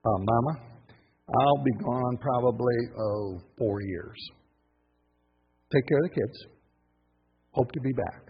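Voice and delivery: low, soft, casual goodbye to wife; lightly voiced king telling his wife he's off; husband leaving. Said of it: "Who did they leave behind?".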